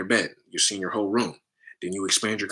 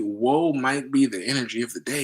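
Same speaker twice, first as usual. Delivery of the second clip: ordinary talk, nothing marked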